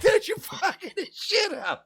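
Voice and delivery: high laughing protest; squeaky laughing outburst; laughing squeal. Retold or imitated. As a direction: break up laughing as you scold. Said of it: "And you're like".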